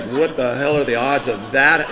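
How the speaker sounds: normal talking voice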